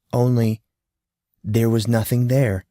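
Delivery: ordinary delivery, nothing marked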